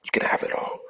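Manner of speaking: soft, hushed surrender to God; retold prayer, low in volume and dying away; murmured prayer. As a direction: pray it quietly, fading to a near whisper. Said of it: "I'm saying this prayer".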